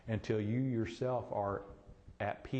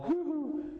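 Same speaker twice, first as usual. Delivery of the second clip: mock woo-hoo. Mocking frantic excitement.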